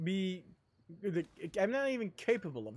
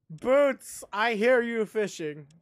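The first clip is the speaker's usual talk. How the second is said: calling the name out high and loud; 4 semitones higher, 9 dB louder than usual. Real, not acted. Streamer calling his dog Boots, loud call. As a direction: call across the yard, higher and louder.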